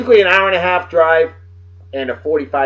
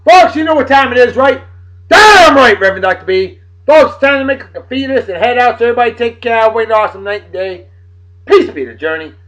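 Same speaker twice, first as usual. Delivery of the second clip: hyped sign-off; 7 semitones higher, 8 dB louder than usual. Real, not acted. Sign-off catchphrase to viewers.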